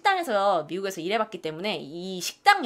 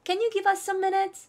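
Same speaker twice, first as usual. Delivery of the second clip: much higher and slow, bright model; higher, slow second take; 10 semitones higher, much slower than usual. Shown as a demonstration. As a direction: lift it way up the second time. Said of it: "다시 한번". (두 번째는 훨씬 높게 천천히)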